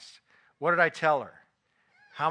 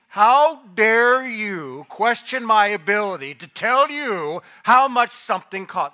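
loud, higher, slow and outraged; hammed-up wounded pride, demanding an answer; mock outrage at being questioned by his wife; offended indignation; 5 semitones higher, 11 dB louder, slower than usual. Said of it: "that's the spirit of, of the way I said it".

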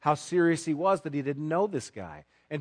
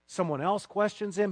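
ordinary delivery

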